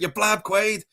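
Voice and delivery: high-pitched, mock-angry film quote; acted-out scolding, pitched well up; agitated accusation. Film fan quoting the man who turns on Quaid, agitated accusation.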